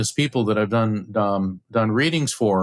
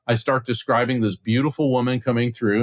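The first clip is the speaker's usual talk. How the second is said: normal talking voice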